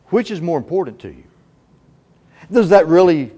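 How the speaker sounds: usual speaking voice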